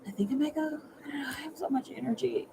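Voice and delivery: fast hushed whisper. Real, not acted. Reader talking softly to her live audience. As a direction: whisper it quickly. Tired late-night reader.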